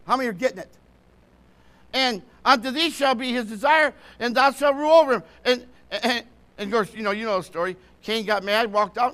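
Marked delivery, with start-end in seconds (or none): 1.9-5.2 high, loud verse declamation; forceful preaching. Acted. Preacher proclaiming the Lord's word to Cain, loud and forceful. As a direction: proclaim it to the whole room.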